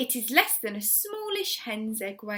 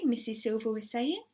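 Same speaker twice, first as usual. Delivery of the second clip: ordinary delivery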